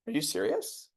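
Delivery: high, soft mock disbelief; lightly voiced shock at the price; incredulous husband. Husband balking at the knife price, mock incredulous and high.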